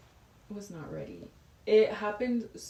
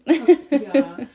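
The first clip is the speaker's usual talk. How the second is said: loud, higher laughing yes; laughing agreement; 4 semitones higher, 15 dB louder than usual. Real, not acted. Teller agreeing with her amused listener, laughing loudly. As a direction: agree right through a laugh.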